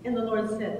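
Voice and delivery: ordinary talk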